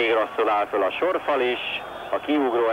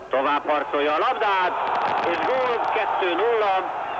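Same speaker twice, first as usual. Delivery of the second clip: goal shout, high and rising; excited goal call; 8 semitones higher than usual. Real, not acted. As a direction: call the goal with a burst of joy. Goal scored, second goal. (izgatott gólkiáltás)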